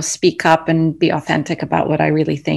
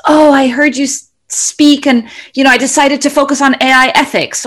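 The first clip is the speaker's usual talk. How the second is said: higher, lightly voiced fan; a touch of a fan who heard her speak, voiced higher; quoted fan; 8 semitones higher than usual. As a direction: pitch it up as an admirer telling her about a talk. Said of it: "if somebody says".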